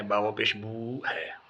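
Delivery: "بو" drawn out long and slow; "با پیش بو" read very slowly with "بو" held long; stretched vowel. Shown as a demonstration. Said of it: "یہاں تو کھینچنا ہے".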